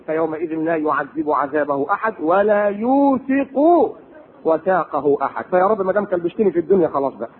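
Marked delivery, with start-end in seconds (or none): 0.0-5.4 slow, low chanted verse; lower slow recitation. Acted. Preacher recites the verse low and slow, thinking of the afterlife.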